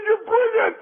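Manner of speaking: high-pitched burst of admiration; delighted. Acted. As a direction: exclaim it like a relieved drunk husband. Acted delighted reply in joke.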